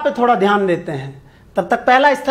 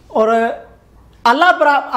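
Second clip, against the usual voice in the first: usual speaking voice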